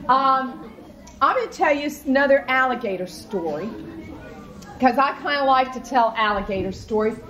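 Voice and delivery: ordinary delivery, nothing marked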